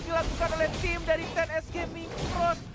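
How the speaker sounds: high, loud play-by-play shout; hyped esports casting. Acted. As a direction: call the kill with rising hype. Casters hype a first blood.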